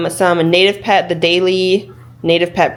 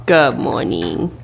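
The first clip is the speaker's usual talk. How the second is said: talking to a dog; 7 semitones lower, much slower than usual. Real, not acted. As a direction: murmur a gentle good morning. Waking sleeping dog.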